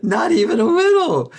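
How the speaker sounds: laughing it out, high and loud; real laugh through the words. Real, not acted. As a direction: burst out laughing as you agree. Laughing agreement about India.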